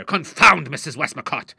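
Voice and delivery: loud, frenzied outburst; exasperated father shouting; angry cry. A narrator's reading. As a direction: explode at the name. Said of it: "he cried, striking frenziedly".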